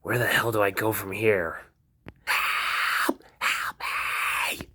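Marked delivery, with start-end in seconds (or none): 2.2-4.7 desperate cries for help. Acted. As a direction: scream for your life. A victim screams for help.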